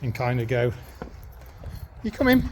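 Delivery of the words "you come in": high, singsong call to the dog; rising high come-in call; coaxing call. Real, not acted. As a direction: coax him inside with a high voice.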